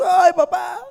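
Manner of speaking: high, light happy call to papa; a happy girl calling her father; happy daughter. Acted. Daughter greeting her father, high and happy. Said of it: "Y la hija feliz".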